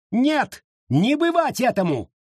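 high-pitched cry of protest. A narrator's reading. Abita refuses the sale of the land, crying out. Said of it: "закричала Абита".

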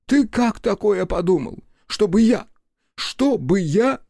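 high, shocked priest repeating himself; appalled high-pitched priest; outraged priest. Acted.

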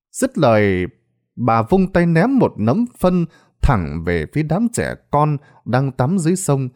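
usual speaking voice

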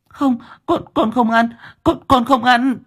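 high, stammering tearful refusal; crying little girl. A narrator's reading. Little girl refusing the forced rice, tearful. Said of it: "khóc ré lên".

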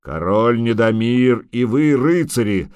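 slow, booming dragon proclamation; roaring dragon. A narrator's reading. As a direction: roar it like a brass trumpet. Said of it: "зарычал он так, словно это была латунная труба".

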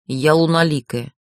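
ordinary delivery, nothing marked

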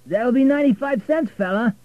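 loud, high cabbie voice; cab driver. A narrator's reading. Driver asking Richard for the fare, loud and high. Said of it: "said the driver".

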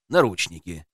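ordinary delivery

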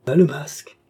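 high, merry call; joyous cry. A narrator's reading. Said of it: "lui cria une femme d'une voix joyeuse".

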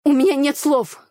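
loud statement. A narrator's reading. Heroine loudly scolds the brothers after the fight. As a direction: declare it loudly, fed up. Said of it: "громко констатировала я".

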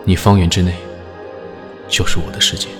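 ordinary talk

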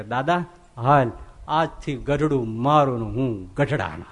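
tender embrace. Retold or imitated. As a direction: hold him close and say it slowly. Master embraces weeping devotee.

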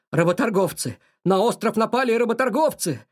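loud, out-of-breath alarm call; breathless alarm. Acted. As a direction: gasp out the warning loudly. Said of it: "запыхавшись, сказал он".

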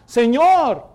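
loud, high call to the Lord; a cry of "Señor" rising in pleading. Acted. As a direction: call out to him for help.

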